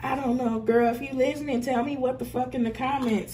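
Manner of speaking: ordinary talk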